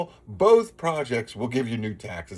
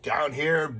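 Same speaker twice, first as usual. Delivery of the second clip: normal talking voice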